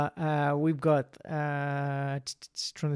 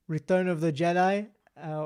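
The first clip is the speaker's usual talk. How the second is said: ordinary talk, nothing marked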